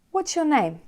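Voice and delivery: "What's your" high, "name" falling; stress on first part. Shown as a demonstration. Teacher recaps open questions, high start on "What's". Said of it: "We stress the first part".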